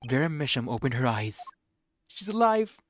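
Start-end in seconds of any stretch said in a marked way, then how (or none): 2.1-2.8 higher, louder delighted shout; overjoyed call; excited cry. Acted. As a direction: alive rung out high. Character voices joy.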